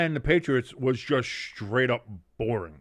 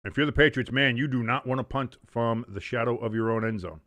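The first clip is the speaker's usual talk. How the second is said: play-by-play; 4 dB louder than usual. Acted. Play-by-play call of the game.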